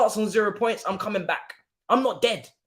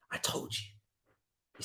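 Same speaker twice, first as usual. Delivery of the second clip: whispered I told you; whispered gloat; 15 dB quieter, faster than usual. Real, not acted. Fan gloating at a rival over the lost trophy, whispered. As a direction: breathe it like a smug secret.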